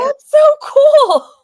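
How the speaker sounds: high, loud and slow delight; excited. Real, not acted. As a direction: squeal it out, thrilled. Reacting to the tub.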